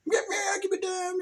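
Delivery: high squeaky kitty voice; cat voice. Acted. Voicing the crucified toy cat, high whine. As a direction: whine like a little trapped cat.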